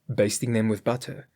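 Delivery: plain reading voice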